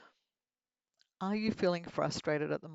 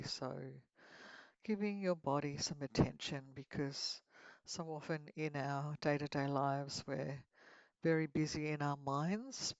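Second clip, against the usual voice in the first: guided meditation; 6 dB quieter than usual. Acted. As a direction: lead them gently into relaxation.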